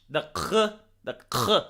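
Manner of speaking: low, throat-scraped "k" as a kh; guttural kh. Shown as a demonstration. Showing how Arabic speakers say it, "k" as a throaty kh. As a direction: rasp "k" in the back of the throat. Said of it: "they say the h".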